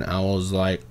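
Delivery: normal talking voice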